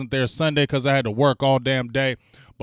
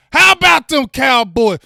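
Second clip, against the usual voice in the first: shouted high and loud to the chat; rally the fans with a big shout; hype shout; 9 semitones higher, 8 dB louder than usual. Real, not acted. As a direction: team cheer yelled out. Hype chant to viewers.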